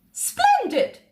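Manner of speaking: very high, grand exclamation; a queen thrilled by a clever idea; delighted Queen. A narrator's reading. Queen approving Sam's plan, delighted.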